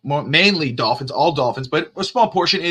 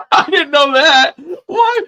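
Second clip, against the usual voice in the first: higher, slow, laughing through the words; laughing disbelief; 11 semitones higher, 6 dB louder, slower than usual. Real, not acted. Host reacting to the tweet, higher slow laughing disbelief. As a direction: say it while cracking up.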